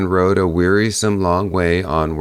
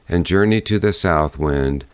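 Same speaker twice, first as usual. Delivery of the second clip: usual speaking voice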